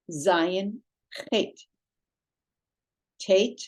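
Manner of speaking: each letter named slowly with a pause after it; teacher drilling the Hebrew alphabet; slow alphabet recitation. Shown as a demonstration. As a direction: recite the letters clearly for students to repeat.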